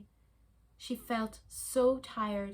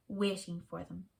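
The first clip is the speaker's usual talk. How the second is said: ordinary delivery, nothing marked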